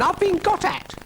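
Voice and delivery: very high, outraged squeal; shrieked. A narrator's reading. Brian complains to Florence of Dougal's experiment, high shriek. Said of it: "shrieked Brian".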